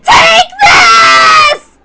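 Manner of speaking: very high, loud screaming war cry; battle cry. Acted. Jessica screams as she throws her final punch.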